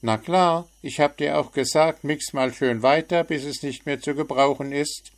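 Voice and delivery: spöttisch; mocking. A narrator's reading. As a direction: sneer it out sarcastically. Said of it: "spottete der Vogel".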